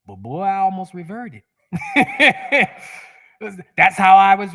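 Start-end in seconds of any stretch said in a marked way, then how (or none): 1.7-3.0 big laugh. Real, not acted. Laughing at himself.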